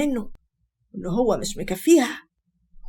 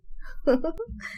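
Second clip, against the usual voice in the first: higher, quiet giggle; soft laugh; 6 semitones higher, 5 dB quieter than usual. Acted. Man and wife mocking her husband, soft giggle. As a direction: giggle softly at the joke.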